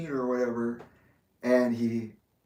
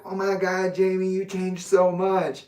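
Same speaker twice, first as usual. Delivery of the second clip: high-pitched mock astonishment; mocked acquaintances; 7 semitones higher, 4 dB louder, slower than usual. People remarking on how the teller changed, mock amazed. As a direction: mimic people gushing, pitched up and drawn out. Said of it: "when people are like".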